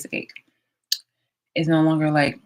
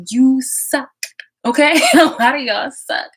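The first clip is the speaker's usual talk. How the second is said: loud mocking jab; 9 semitones higher, 9 dB louder, slower than usual. Real, not acted. Mocking rant at audience.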